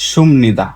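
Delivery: সুমনিদা with m before n, no p; nasalised sound change. Shown as a demonstration. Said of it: "তা উচ্চারণের সময় করবেন". (সুমনিদা, প নয় ম দিয়ে)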